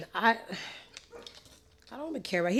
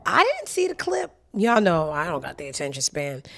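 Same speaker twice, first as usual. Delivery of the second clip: normal talking voice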